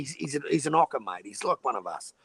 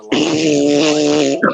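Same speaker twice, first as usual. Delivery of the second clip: drawn out; 16 dB louder than usual. Pretend. Drawn-out fishing boast.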